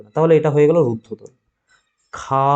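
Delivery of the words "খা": খা held long on its vowel; one sustained খা; drawn-out vowel. Shown as a demonstration. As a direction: stretch the "aa" of খা right out. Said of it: "টেনে উচ্চারণ করতে পারবে".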